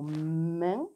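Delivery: "main" on a long hummed m, rising at the end; word sounded out. Shown as a demonstration. Teacher reminds pupils of the spelling, "main" slow with a long m. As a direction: draw out "main" slowly from m to vowel. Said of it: "Dans le mot".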